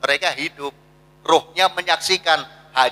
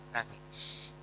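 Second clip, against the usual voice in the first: nangis dropped to a voiceless whisper; 8 semitones lower, 21 dB quieter than usual. Acted. Preacher to congregation on the cruel who do not weep, whispered. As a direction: hush it, a bare whisper. Cucu dying yet no tears.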